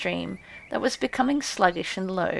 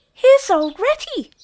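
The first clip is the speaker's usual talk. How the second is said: high, much louder mouse cry; high squeaky field mice crying out; 14 semitones higher, 10 dB louder than usual. A narrator's reading. Field mice calling out to Ratty, high and loud. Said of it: "they cried".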